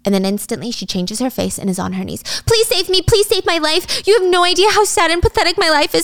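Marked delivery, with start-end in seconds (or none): 2.5-6.0 high, hammed-up begging; desperate pleading. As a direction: plead pitifully in one breathless rush. Angela begs on her knees.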